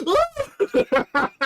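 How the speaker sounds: very high, loud imitated shriek; mock shocked scream. Streamer mimicking a woman's shriek at the screen, loud and high. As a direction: yelp like a horrified viewer. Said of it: "this one woman's like".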